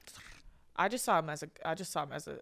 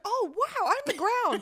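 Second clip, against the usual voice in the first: much higher, marvelling; retold wonderstruck awe; 13 semitones higher, 4 dB louder, slower than usual. Tripper realizing she is the ground, mock awestruck. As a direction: act out an amazed revelation, pitched way up.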